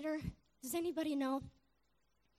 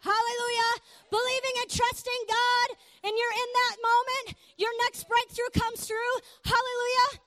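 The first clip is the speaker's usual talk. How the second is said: higher, louder preaching to the room; preaching at full force; 6 semitones higher, 5 dB louder than usual. Acted. Preacher leading the church through steps, higher and louder preaching. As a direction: drive it home like a revival preacher.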